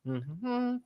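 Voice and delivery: talking while holding in smoke; words around a hit of smoke; exhaling smoke. Real, not acted. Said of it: "let me take a hit".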